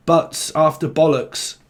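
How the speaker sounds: usual speaking voice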